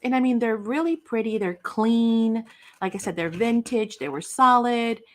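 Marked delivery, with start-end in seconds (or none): none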